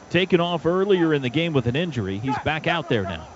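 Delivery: usual speaking voice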